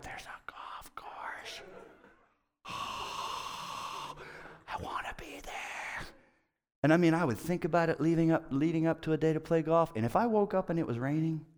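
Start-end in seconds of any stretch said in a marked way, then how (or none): 0.0-6.0 whispered with a sigh, slow and longing. Retold or imitated. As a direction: breathe it like a secret craving. Longing thought while driving.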